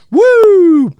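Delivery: loud high whooping cry; loud falsetto party whoop; wild whoop. Acted. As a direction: let out a manic woo.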